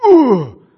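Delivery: loud, high wince sound; a loud pained ooh. Retold or imitated. Preacher imagining bad news, a loud high wince. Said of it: "I'm gonna be like".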